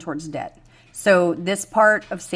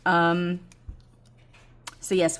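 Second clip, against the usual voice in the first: usual speaking voice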